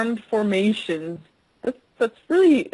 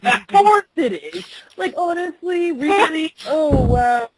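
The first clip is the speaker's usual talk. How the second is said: higher, loud mocking sarcasm; mock him, jaded; sarcastic; 7 semitones higher, 4 dB louder than usual. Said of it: "You're so jaded".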